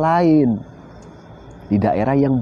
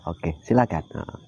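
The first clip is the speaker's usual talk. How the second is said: ordinary talk, nothing marked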